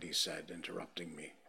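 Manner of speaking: usual speaking voice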